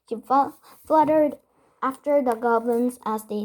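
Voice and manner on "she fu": usual speaking voice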